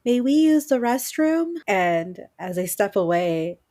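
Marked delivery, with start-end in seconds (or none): 0.0-1.5 put-on high, loud eerie child voice; creepy child. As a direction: ask sweetly like a creepy kid. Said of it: "the kids are like".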